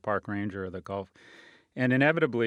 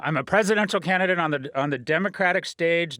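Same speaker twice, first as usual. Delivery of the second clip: ordinary talk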